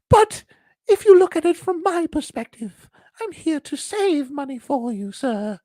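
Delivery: very high squeaky falsetto; pleading wallet. Acted. Wallet reasoning with the man, pleading. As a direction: argue meekly in a tiny high voice. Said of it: "the wallet says to the man".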